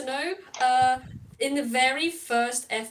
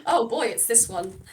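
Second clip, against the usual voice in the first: usual speaking voice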